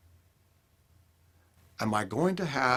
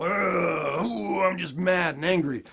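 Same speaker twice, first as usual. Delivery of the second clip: grumpy groan; 6 semitones higher, 3 dB louder, slower than usual. Retold or imitated. Dad grumbling walking in home.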